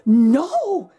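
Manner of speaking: loud, startled cry of no; mother crying out. Acted. Mother reacting to Jack's news, a loud cry. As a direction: let the mother cry out in disbelief. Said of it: "she cried".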